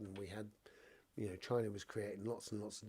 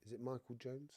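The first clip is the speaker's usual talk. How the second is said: ordinary delivery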